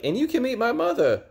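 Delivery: high falsetto imitation of the girl; mock girly voice. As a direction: squeak it out like a sweet girl. Girl voiced in retold story.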